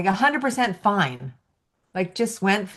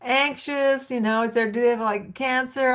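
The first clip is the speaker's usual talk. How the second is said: much higher, anxious fretting owner; worried owner; 7 semitones higher than usual. Retold or imitated. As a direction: fret over a sick pet. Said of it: "It's like".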